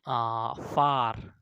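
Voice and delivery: फार said very slowly with the आ vowel drawn out; stretched demo. Shown as a demonstration. Teacher drawing out फार slowly for pupils. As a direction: drag out the आ in फार. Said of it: "इसके साथ-साथ हम".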